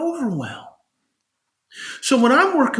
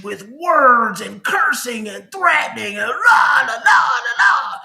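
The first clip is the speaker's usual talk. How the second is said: louder, higher la la la; mock rage; 4 semitones higher, 5 dB louder than usual. Loud high mockery of the daughter's old rages. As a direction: parody the tantrum.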